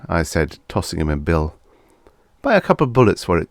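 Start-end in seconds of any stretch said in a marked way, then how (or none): 2.4-3.5 fast, tossed-off instruction; quick throwaway. A narrator's reading. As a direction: rattle it off lightly. Boss rattles off order.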